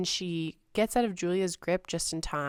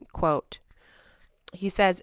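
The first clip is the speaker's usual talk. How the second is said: usual speaking voice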